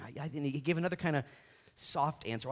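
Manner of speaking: ordinary talk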